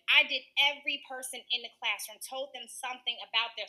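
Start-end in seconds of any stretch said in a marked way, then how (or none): none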